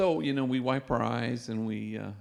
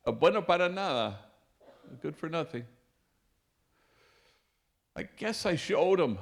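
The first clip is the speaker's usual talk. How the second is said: proud outburst; 5 semitones higher, 4 dB louder than usual. Retold or imitated. Proud father, eyes welling up.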